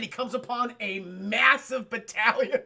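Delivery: plain reading voice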